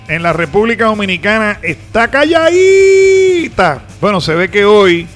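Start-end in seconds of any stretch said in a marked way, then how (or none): 1.6-3.8 teasing. Real, not acted. Teasing the quiet Dominican listeners.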